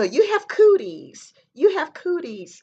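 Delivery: put-on high, loud sing-song kid taunt; mocking schoolyard chant; childish taunt. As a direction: tease like a second grader in the playground. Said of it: "the guy was like".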